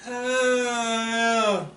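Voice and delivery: high, drawn-out mock scream of a woman; imitated woman's scream. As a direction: copy the blood-curdling scream from the dark. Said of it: "this blood-curdling scream".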